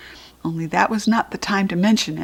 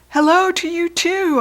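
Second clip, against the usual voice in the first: higher, louder call down the hall; shouted back; 9 semitones higher, 4 dB louder than usual. Acted. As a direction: yell back to grandma across the house. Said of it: "I shouted back".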